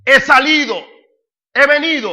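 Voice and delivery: loud, high proclamation; angel's stern confrontation. Acted. Acted angel confronting Balaam.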